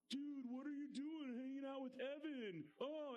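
put-on high teasing jeer from the cool kids; mocking friends. Friends tease the speaker for hanging out with Evan, high mocking jeer. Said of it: "my homies over there start going".

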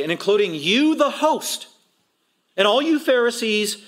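high, loud stress on "you"; single them out, punching "you"; turning on listeners. Retold or imitated. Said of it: "he turns it on them".

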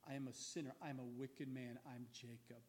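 low and soft, fading away; hushed confession. Retold or imitated. Jacob confessing to God he is a sinner, hushed. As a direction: confess it quietly, ashamed.

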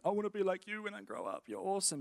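gushing admiration. Retold or imitated. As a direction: gush quietly to your idol in a rush. Gushing child admiring a funny leader.

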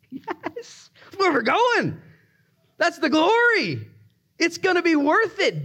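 high, exultant cry; joyful, high-pitched preacher's outburst; exultant preaching. Real, not acted. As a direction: proclaim it in a high ringing voice. Preacher exulting about heaven.

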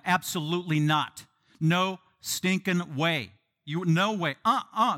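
loud, drawn-out refusal on "Absolutely not"; emphatic no. Acted. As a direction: hit "No stinking way" hard and slow, word by word. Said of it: "it's an emphatic no".

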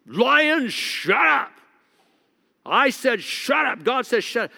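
shouting at lions. Retold or imitated. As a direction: shout it like ordering beasts silent. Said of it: "he'd be shouting at the lions".

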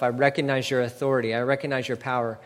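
ordinary talk

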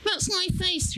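very high squeaky voice; small squeaky answer; squeaky puppet voice. Pretend.

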